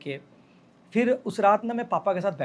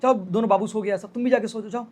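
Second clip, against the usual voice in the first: imitated mother's telling-off, higher and louder; retold scolding, insistent and raised; strict scolding; 5 semitones higher, 5 dB louder than usual. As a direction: order the child off to bed like a strict mum. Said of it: "तो वो बहुत strictly डांट रही थी".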